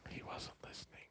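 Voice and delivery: teasing whisper aside. Real, not acted. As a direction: whisper it as a joke. Teasing aside about a host.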